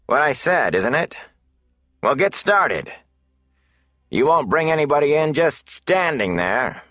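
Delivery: innkeeper Hake. A narrator's reading. Innkeeper Hake dismissing Rand and Matt, lightly voiced impatience. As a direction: brush them off and hurry them to work.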